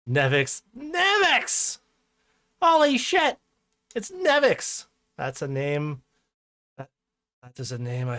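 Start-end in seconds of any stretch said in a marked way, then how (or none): 0.8-1.8 squealing with sudden excitement; excited high shout. Real, not acted. Excitedly calling out viewer's name. 2.6-4.9 excited shout. Real, not acted. Excited greeting of returning viewer.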